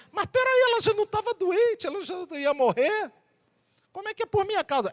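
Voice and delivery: Jesus taken aback by the blame; high startled objection; surprised protest. Retold or imitated. Jesus answers, voiced.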